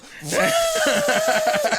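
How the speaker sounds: squealing laugh. Real, not acted. Laughing while retelling.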